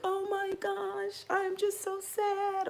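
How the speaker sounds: high, slow, soft mock whimper; mock weepy Pisces. Her old Pisces self, teased in a high slow whimper. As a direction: whine it up high and drawn out.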